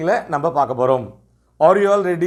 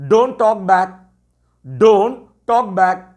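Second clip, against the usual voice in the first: slow teacher model phrase; 3 dB louder, much slower than usual. Shown as a demonstration. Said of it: "இல்லாட்டி".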